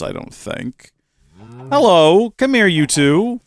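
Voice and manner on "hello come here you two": higher, louder call to stray bees; coaxing call after wandering animals. Real, not acted. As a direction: call the bees back like runaway pets. Calling out to escaped bees.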